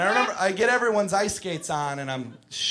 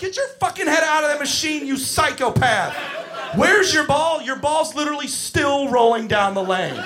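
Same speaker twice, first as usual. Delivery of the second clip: high, loud angry shout; exasperated yelling; 8 semitones higher, 4 dB louder than usual. Retold or imitated. Counselor yelling at kid in bowling machine, exasperated. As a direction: snap at the kid. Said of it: "I'm like".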